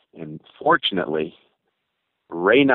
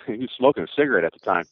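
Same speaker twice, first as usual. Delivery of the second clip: ordinary talk, nothing marked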